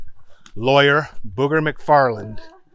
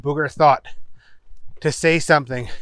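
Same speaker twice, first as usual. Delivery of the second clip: plain reading voice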